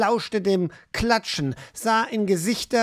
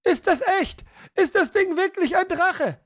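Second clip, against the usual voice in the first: high-pitched, alarmed voice from a crowd; frightened townsperson, high and urgent; anxious questioning; 11 semitones higher than usual. A narrator's reading. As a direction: ask in rising panic, pitched well up. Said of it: "erklangen erste zittrige Stimmen".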